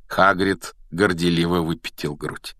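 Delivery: ordinary delivery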